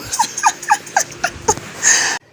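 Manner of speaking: a high, breathy mocking laugh. Wife laughing at her angry husband over his food, mocking. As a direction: laugh at him without a voice.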